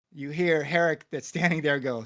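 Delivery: ordinary talk, nothing marked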